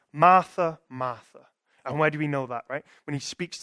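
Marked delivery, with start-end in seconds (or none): 0.1-1.5 authoritative call. Retold or imitated. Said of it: "He just goes".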